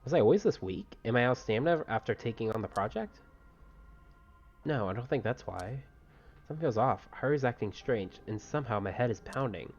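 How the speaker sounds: soft, understated read of inner thoughts; story narration. Acted. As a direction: read the hero's musings quietly, lightly acted. Reads the hero's inner thoughts.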